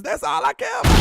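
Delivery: high, loud excited yell; excited shout. Real, not acted. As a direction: shout it out with joy. Excited outburst about winning.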